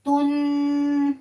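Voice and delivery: तुन drawn out slowly, the n hidden lightly in the nose; ikhfa nasal hold. Shown as a demonstration. Quran teacher demonstrating ikhfa, slowly drawn तुन. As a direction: stretch तुन and tuck the n into the nose. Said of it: "खींच के नाक में छुपा के पढ़ेंगे".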